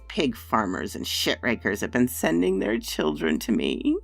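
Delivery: put-on loud, sneering old crone; mocking witch. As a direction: scorn the peasants like a hag. Witch mocks the parents.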